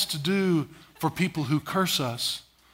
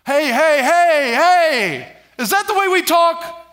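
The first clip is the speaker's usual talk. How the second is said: put-on raised, higher hey hey calls to a child; a parent sharply calling kids to order; scolding parent; 6 semitones higher than usual. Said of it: "But say".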